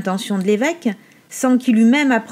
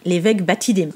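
ordinary delivery

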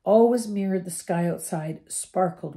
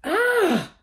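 high, sharp cry of alarm; startled cry; 13 semitones higher, 5 dB louder than usual. Acted. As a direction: yelp in fright. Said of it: "said Ron".

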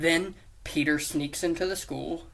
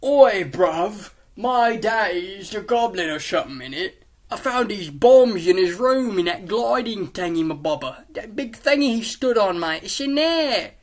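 high, loud mock British voice; put-on British accent; 5 semitones higher, 8 dB louder than usual. Harry telling Peter his daddy's the goblin, loud mock British accent. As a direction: ham up an agitated British "bruv" accent. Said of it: "doing accents to change it".

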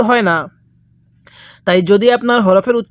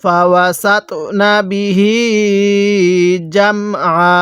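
measured chant with the last "hee" of বিহি held; slow Quran recitation; slower than usual. Shown as a demonstration. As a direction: recite slowly, stretching the end of বিহি. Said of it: "এরকমভাবে শিখব".

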